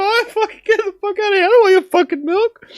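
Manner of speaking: very high, loud refusal; high mock refusal. Narrator refusing festival milk, very high loud squeal. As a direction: squeal a panicked no. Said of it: "So I'm just like".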